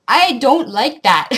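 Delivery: stern, slow objection; firm dislike. Real, not acted. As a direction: put your foot down. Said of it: "I really dislike".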